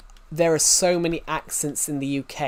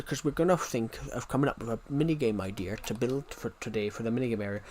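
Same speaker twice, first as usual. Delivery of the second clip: usual speaking voice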